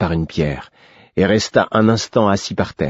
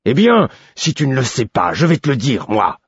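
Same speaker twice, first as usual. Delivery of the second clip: higher, louder roar; 7 semitones higher, 4 dB louder than usual. A narrator's reading. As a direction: bellow it at the boy. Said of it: "rugit-il". (rugi avec colère)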